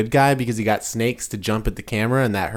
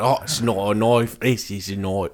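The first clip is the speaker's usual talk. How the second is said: a Cockney accent slipping in for an Australian one, softer; 4 dB quieter than usual. Shown as a demonstration. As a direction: botch an Aussie line into Cockney. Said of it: "it'll become Cockney every time I try to do Australian".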